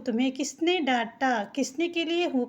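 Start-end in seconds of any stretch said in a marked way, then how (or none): none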